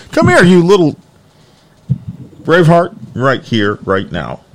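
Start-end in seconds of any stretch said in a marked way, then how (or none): 0.1-3.0 high, loud teasing call; high mock-scolding call; calling the dog. Real, not acted. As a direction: tease the dog into coming.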